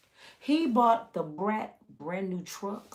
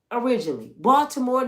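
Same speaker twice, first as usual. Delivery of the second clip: ordinary delivery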